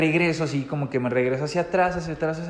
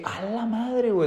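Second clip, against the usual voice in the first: shocked exclamation; 7 semitones higher than usual. Retold or imitated. Shocked exclamation seeing person hanging.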